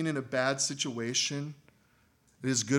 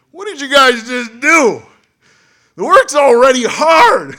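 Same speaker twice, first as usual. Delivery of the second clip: angry shout; 13 semitones higher, 18 dB louder than usual. Acted. Israelites angry at Moses, Aaron.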